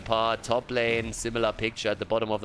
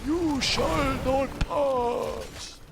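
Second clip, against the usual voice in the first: high, very slow booming wizard; hammed-up old wizard command; old man wizard voice; much slower than usual. Shown as a demonstration. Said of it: "we went for the old man".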